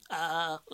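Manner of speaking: long, soft sigh of relief; breath out, then a soft "ah"; great relieved sigh. Acted. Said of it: "gave a great sigh of relief".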